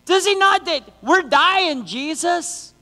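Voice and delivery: high-pitched mock panic, dragged out; panicking disciples. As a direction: stammer in, then wail like a panicked disciple. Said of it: "disciples are standing there like".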